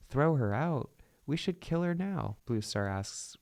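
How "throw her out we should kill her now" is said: raised, higher outraged voice; dramatic outburst calling for punishment. Acted. Angry cat demanding a prisoner's death, outraged.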